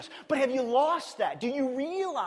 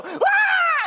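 sudden high shriek, much louder; scream at goat; 9 dB louder than usual. Retold or imitated. Pastor imitating someone screaming at a fainting goat. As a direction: yell to startle a goat. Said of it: "they run up and go".

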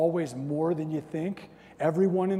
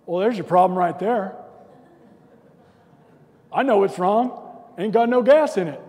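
loud, know-it-all onlooker; mock helpful passerby; unhelpful bystander; 4 semitones higher, 8 dB louder than usual. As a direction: state the obvious loudly, like a clueless helper. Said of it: "I'll be the guy that walks up and goes".

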